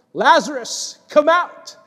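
calling out loud and high, come out rising; loud cry. Acted. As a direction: summon the dead man with a loud cry. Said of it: "he cried out with a loud voice".

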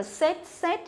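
"set" with short E and sharp t; learner's "set", ending on a voiceless t; wrong pronunciation demo. Retold or imitated. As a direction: imitate the mistaken "set" for sad. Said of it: "nhưng mà là sai".

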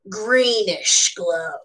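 usual speaking voice

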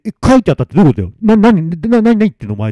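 fast, loud, stuttering bewilderment; panicked questioning. Acted. Masamitsu questioning Noriko's ghost, panicked. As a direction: stammer the question, pitch climbing.

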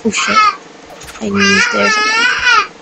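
high, cracking panicked cry; high frantic whimper; panicked whine. Real, not acted. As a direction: voice climbs in sudden alarm. Panicked in game, crying out.

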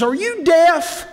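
high, slow, exasperated yell; exasperated suitor. Acted. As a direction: shout it at her slowly, fed up. Frustrated man in joke.

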